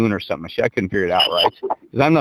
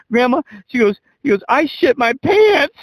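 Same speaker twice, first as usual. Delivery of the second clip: mock wailed confession; 12 semitones higher than usual. Son confessing to Grandma, imitated in a high comic wail. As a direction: imitate a kid confessing, climbing high and slowing down.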